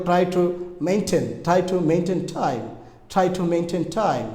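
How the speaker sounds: slow model reading of "try to maintain time", falling at the end; pronunciation demo. Shown as a demonstration. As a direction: show learners the t sounds in "try to maintain time", slowly. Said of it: "উচ্চারণটা এভাবে ব্যবহার করা যায়".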